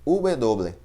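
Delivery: "W" said as "uve doble", slowly; "W" named "uve doble" the Spanish way; Spanish letter name. Shown as a demonstration. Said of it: "Em espanhol chamamos de".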